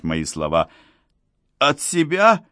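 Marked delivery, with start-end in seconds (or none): 1.6-2.4 high-pitched bewildered babble; babbled question, pitched right up; babbling. A narrator's reading. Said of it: "лепетал он".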